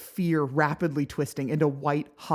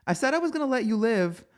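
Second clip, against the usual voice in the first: cocky boast; 9 semitones higher, 5 dB louder, faster than usual. Acted. Boasting to the prince.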